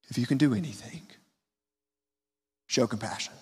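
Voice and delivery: quiet, almost disappointed plea; weak desperate plea. Shown as a demonstration. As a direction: plead softly like a worn-out father. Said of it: "Do you feel the weakness in that voice?".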